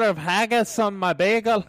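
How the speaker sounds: mock Scottish accent. Host joking to Scottish listeners in a mock Scottish accent. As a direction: ham up a Scot at a bagel shop. Jokes about haggis after Scottish remark.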